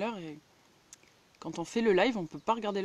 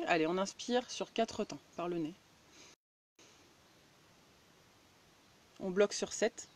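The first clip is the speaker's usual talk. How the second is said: guided breathing; 10 dB quieter than usual. Acted.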